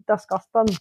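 lightly put-on Scottish impression; Scottish accent. Imagining the Scottish podcaster's reaction, mock Scottish accent. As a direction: mimic a Scot, not very convincingly. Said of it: "Ian's gonna be like".

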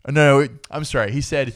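ordinary talk

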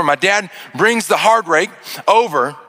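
ordinary talk

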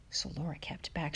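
ordinary delivery, nothing marked

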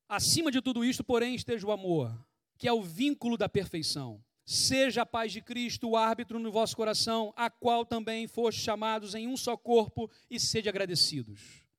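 scripture reading. Acted. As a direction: read the verse out to the church. Reads a Bible passage aloud. (leitura bíblica solene e mais alta)

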